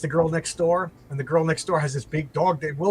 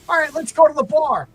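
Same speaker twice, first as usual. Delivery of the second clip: high, loud goofy guy voice; sitcom husband; 10 semitones higher, 6 dB louder than usual. Retold or imitated. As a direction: ham it up like a sitcom dad. Husband leaves for bar.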